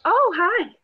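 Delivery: ordinary talk, nothing marked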